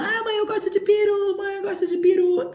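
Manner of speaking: very high childish mock wail; crying little sister. Little sister answering mom's question, high hammed-up tearful wail. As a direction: imitate a little girl wailing her answer to mom. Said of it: "minha irmã que também ficou chorando".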